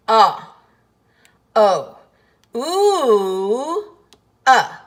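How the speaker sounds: loud, long drawn ah, O, ooh, uh; vowel sounds of O. Shown as a demonstration. Teacher sounding out the four sounds of O. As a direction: sound out each vowel of O slowly. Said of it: "Let's do it together".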